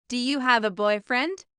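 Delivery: ordinary talk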